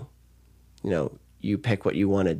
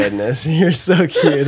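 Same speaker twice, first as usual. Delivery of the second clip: laughing, slow, higher and louder; laughing fondly; 6 semitones higher, 5 dB louder, slower than usual. Real, not acted. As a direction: laugh it out with delight. Laughs, moved by flustered friend.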